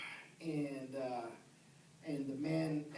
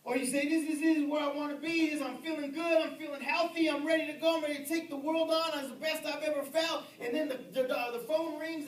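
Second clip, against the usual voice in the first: fast, high and loud preaching; preaching at full force; 8 semitones higher, 7 dB louder, faster than usual. Acted. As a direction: build the excitement, rushing upward. Preacher builds to a shout.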